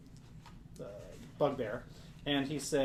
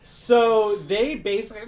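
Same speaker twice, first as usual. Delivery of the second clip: ordinary delivery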